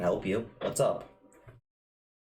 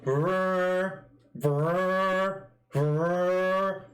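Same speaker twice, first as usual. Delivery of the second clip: horn blasts; 10 semitones higher, much slower than usual. Acted. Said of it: "they start running as he's like".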